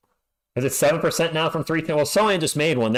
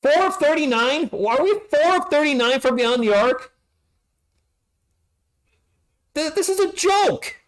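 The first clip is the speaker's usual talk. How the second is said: incredulous disbelief. Real, not acted. Team shooting historically badly.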